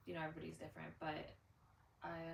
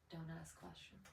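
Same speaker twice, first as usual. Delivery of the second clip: very soft, low, secretive murmur; whispering; 4 semitones lower, 20 dB quieter than usual. Acted. Yacht guests warning the only sober person, whispering. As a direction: drop right down to a near whisper. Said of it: "where everyone else keeps whispering".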